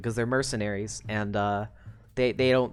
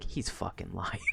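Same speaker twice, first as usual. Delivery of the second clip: low, very soft accusation; Skitter; 7 semitones lower, 11 dB quieter than usual. Acted. Skitter accuses Kaiser at the meeting, low and quiet. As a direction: drop it under your breath. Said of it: "And Skitter's like".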